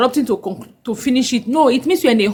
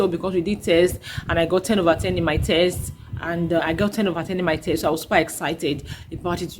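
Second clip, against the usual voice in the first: put-on quiet child voice; 6 semitones lower, 5 dB quieter, faster than usual. Shown as a demonstration. Child explaining test score.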